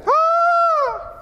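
high, drawn-out fan scream; starstruck squeal. Acted. Amazed crowd running up to greet Jesus, high squeal. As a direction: squeal as if mobbing a celebrity. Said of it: "they run up to him and greet him".

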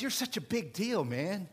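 Satan sweet-talking Jesus, giddy and loud; flattering Satan. Acted. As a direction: gush over him like a smooth-talking devil. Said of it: "Satan always says".

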